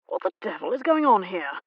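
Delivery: plain reading voice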